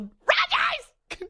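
piercing high-pitched call; fangirl yell. Pretend. Host imagining hailing the quarterback, yelling. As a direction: squeal it like calling across a field. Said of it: "if I yelled".

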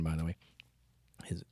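ordinary delivery

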